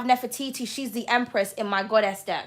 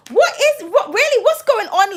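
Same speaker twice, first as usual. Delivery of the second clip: shocked outburst; 9 semitones higher, 6 dB louder than usual. Real, not acted. Shocked by the cards drawn.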